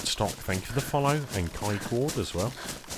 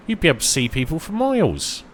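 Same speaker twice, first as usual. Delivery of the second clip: normal talking voice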